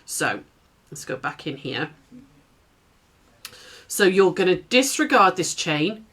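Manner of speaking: ordinary delivery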